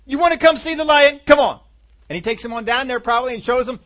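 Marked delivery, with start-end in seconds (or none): 0.1-1.6 high, loud and excited urging; eager insistence. Acted. David begs his family to come see, eager insistence. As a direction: urge the doubters along in a raised young voice.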